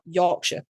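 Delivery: Yorkshire said york-sha, short and cut off; local Yorkshire. Shown as a demonstration. Showing the host the local Yorkshire. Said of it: "It's like, like that".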